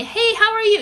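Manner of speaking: high-pitched mock-friendly hello; nice greeting. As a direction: imitate a polite party guest chirping. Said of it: "You know, like, the nice".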